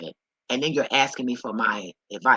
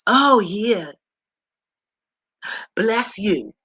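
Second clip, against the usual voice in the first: high, loud sing-song bless you; hammed-up sugary blessing; mock sweet blessing; 7 semitones higher, 6 dB louder, much slower than usual. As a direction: gush it like a church lady. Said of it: "when you say".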